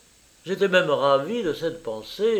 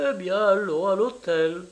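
higher-pitched, scornful retort; contemptuous; 6 semitones higher than usual. A narrator's reading. Italian woman accepts the hotel, contemptuous. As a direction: throw it back with disdain. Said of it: "du ton le plus méprisant".

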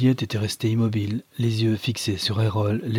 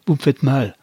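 louder, higher pained protest; acted cry of pain; 5 semitones higher, 6 dB louder than usual. A narrator's reading. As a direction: cry out as if hurt. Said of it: "poussa un cri de douleur".

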